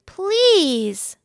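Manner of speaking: high, drawn-out pained protest. A narrator's reading. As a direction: sound offended at the very idea. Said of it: "Ralph was pained".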